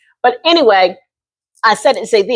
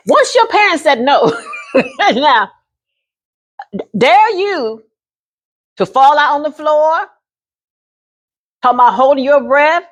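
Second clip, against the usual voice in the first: ordinary talk, nothing marked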